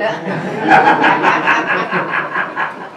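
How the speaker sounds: breathy unvoiced laugh at his own joke; amused chuckle after the punchline. Real, not acted. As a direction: let a soft laugh slip out.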